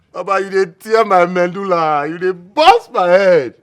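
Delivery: loud frustrated complaint, slowing at the end; he's blowing your mind, let it out loud; exasperated outburst. Acted. Exasperated by friend's confession.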